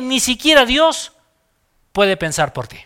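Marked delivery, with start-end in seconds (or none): none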